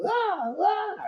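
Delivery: high, strained mock cries; mock holler of pain. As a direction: imitate a man being beaten next door. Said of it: "dude next door holler".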